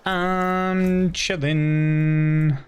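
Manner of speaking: very slow, stretched out; drawn-out chilling. Pretend. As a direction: drag each word out lazily. Repeats "I'm chilling" stretched out.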